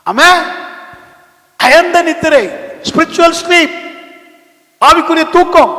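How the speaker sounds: high, loud sermon delivery; full-force preaching. Acted. Pastor telling of Jonah asleep below deck, full-force preaching.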